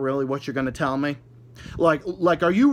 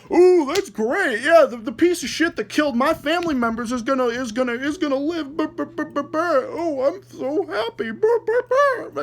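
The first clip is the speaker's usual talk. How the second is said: mock the idea that a victim's family would cheer; mock happy family; 11 semitones higher, 4 dB louder than usual. Mocking imagined victim's family, high-pitched and sarcastic. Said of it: "did you fucking expect them to be like".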